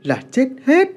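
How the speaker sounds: firmly calm his mother down; speaking loudly. A narrator's reading. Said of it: "anh nói to". (nói to)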